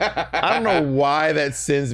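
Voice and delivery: slow, laughing through the words; can barely get it out for laughing. Real, not acted. Laughing at the joke.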